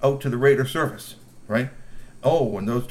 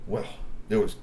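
usual speaking voice

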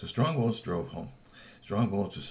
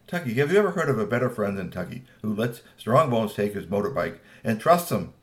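plain reading voice

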